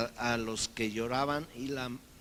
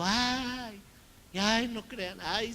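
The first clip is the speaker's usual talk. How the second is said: higher, louder mocking whine; mocking jeer; 11 semitones higher, 7 dB louder, much slower than usual. Mockers in the house ridicule Jesus, high mock whine. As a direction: jeer at him in a silly high voice.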